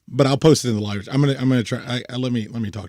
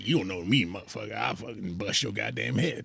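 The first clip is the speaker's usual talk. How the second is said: put-on Bayou Dan accent; 7 dB quieter than usual. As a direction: growl it like Bayou Dan, the bayou man. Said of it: "that's Bayou Dan right there".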